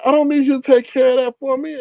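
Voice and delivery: high indignant voice. Retold or imitated. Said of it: "He's like".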